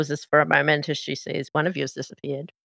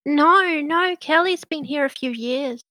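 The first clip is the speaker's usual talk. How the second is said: high, bubbly, gossipy small-town waitress; rattling on brightly about the kitchen girl; chatty Meadow; 5 semitones higher than usual. Acted.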